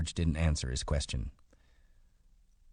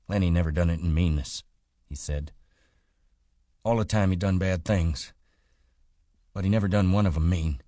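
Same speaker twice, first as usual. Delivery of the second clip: a hint of George, louder and fast, insisting; George, earnest; 3 semitones higher, 6 dB louder, faster than usual. A narrator's reading. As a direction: press the point quickly and louder. George defends Lenny.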